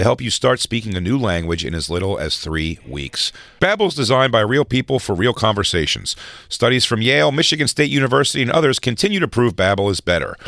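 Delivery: brisk sponsor read. Acted. Host reading a sponsor spot to listeners, ad read. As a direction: pitch the app like a radio ad.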